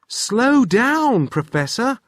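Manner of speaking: high, loud call to slow down. Acted. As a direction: call across to the professor. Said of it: "called Wilf".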